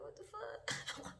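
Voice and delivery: high, slow, breathy drawn-out what the fuck; drawn-out disbelief. Retold or imitated. Retelling seeing the boy again, slow high stunned disbelief. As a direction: stretch it out, stunned all over again. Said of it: "And I'm like".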